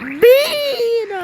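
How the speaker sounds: high laughing cry "Bean!"; delighted game shout. Real, not acted. Excited callout with laugh.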